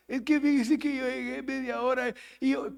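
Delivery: high-pitched put-on excuse; mimicked whining excuse. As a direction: play a late worker pleading his case. Mimics fired worker making excuses.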